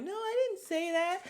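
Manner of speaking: high, squeaky imitation of a denial; mock denial. Vlogger mocking Candace's lie, high squeaky denial. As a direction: mimic her insisting she never said it.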